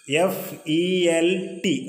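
"എഫ് ഇ എൽ ടി" spelled letter by letter, very slow; teacher spells the word out for the class, very slowly; slow spelling out. Shown as a demonstration. Drawn-out letter-by-letter spelling. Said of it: "ഇതിൽ പ്രത്യേകം ശ്രദ്ധിക്കേണ്ടത് എൽ ടി ആണ്".

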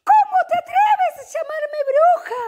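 high furious yell; angry shout. Acted. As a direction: scream in offended outrage. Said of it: "gritó la mujer".

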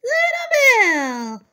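slow, high, loud cry of his name; alarmed cry. Pretend. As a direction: wail it in dismay. Bully arrives, Bonnie alarmed.

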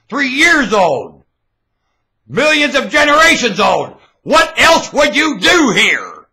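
high, drawn-out bellow; loud, high tirade; shouted preaching. Real, not acted. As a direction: thunder it out at full voice.

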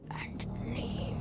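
breathy whisper, drawn out; whispered plea. Real, not acted. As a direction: whisper it like a secret wish. Coaxing chef, whispered.

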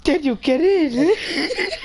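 slow, wheezing words through laughter; laughing so hard. Real, not acted. To his friend in the game, crying with laughter. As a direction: barely get it out for laughing.

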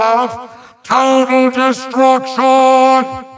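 high, slow, stretched-out proclamation; grand incantation. Acted. Villain summons particularly evil spell, slow high incantation. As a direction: draw the spell out to a climax.

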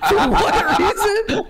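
high, breathless laughing; cracking up uncontrollably; hysterical laugh. Real, not acted.

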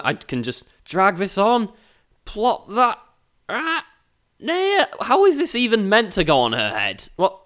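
ordinary talk, nothing marked

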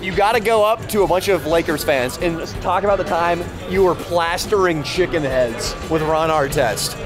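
ordinary delivery

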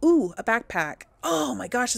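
normal talking voice

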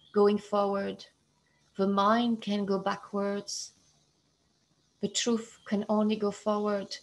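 ordinary talk, nothing marked

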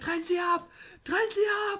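quoting the trailer voice. Retold or imitated. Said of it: "wie er sagt".

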